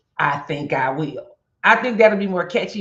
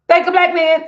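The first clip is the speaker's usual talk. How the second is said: catchphrase shout; 12 semitones higher, 10 dB louder than usual. Pretend. Catchphrase for her reels.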